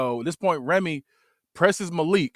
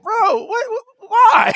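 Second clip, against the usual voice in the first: much higher, loud, crying out why; whiny bewildered roommate; 9 dB louder, much slower than usual. Retold or imitated. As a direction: shriek in hurt disbelief. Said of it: "he was like".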